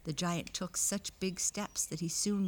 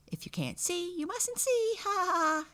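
very high, loud cackly little-man voice; high-pitched gleeful taunt; laughing tiny man; 13 semitones higher, 4 dB louder than usual. A narrator's reading. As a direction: squeal it out and end on a drawn laugh. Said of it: "he answered with a laugh".

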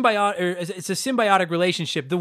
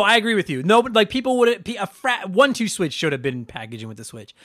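ordinary delivery

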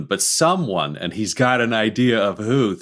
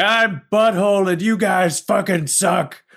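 higher, slow character voice, far from his own; gruff character voice; 8 semitones higher, slower than usual. Acted. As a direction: sneer it out as a rude brawler. Player voicing his character.

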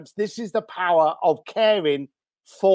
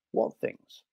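quiet, probing question; Chris Voss; 8 dB quieter than usual. Retold or imitated. [name] gently presses the caller for his why, quietly. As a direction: ask it softly, leaving room for an answer. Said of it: "in my best Chris Voss voice".

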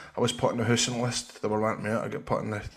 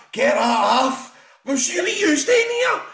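high, loud mock protest; exaggerated yelp at unwanted visitors; hungover protest; 6 dB louder, slower than usual. Hungover man woken by family, high indignant protest.

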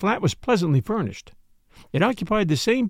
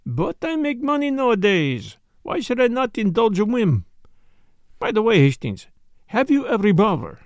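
put-on higher-pitched French-accented detective; Poirot, breezy self-satisfaction; 6 semitones higher than usual. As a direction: brush off the protest airily, French accent.

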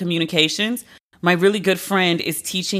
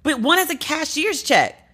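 exasperated outrage; 8 semitones higher than usual. Real, not acted. Ranting about held cashier's check.

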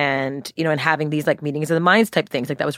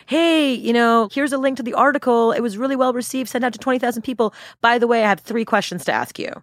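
higher, sing-out greeting into a casual request; put-on sweet, higher student voice; friendly student; 7 semitones higher than usual. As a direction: act a chirpy follow-up message. Acting student's friendly follow-up.

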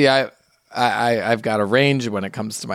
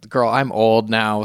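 ordinary talk, nothing marked

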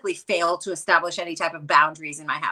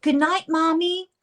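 put-on child voice; 8 semitones higher than usual. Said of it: "I hear her from outside the door".

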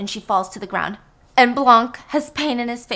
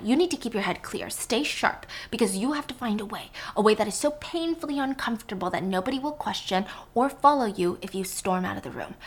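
ordinary talk, nothing marked

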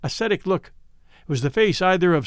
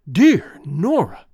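slow, loud, exultant; 3 semitones higher, 6 dB louder, much slower than usual. Acted. Kneeling Lorison exults to Nora, loud and slow. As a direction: savour her name in triumph. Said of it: "he said exultantly".

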